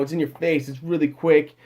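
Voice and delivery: usual speaking voice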